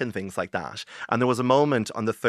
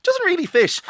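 high, louder incredulous quip; dismayed reaction; 6 dB louder than usual. Retold or imitated. Author hearing her narrator sample, high dismayed squeak. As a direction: squeak out the disbelief at the wrong narrator.

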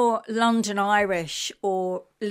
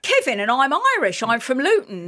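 hammed-up, higher-pitched Luton plumber; 3 semitones higher, 3 dB louder than usual. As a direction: introduce yourself as an eager plumber from Luton. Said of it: "if you're a plumber from Luton".

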